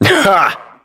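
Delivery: single high "ha" of laughter; short laugh. Acted. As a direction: let out one high, quick laugh. One-word laugh in acted line.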